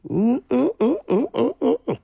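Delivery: high, tutting mm-mm hum; disbelieving hum. Real, not acted. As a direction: hum your disapproval in a high voice.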